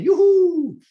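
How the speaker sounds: high, loud happy cheer; excited whoop. Retold or imitated. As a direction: whoop it out with joy. Cheering a first audition.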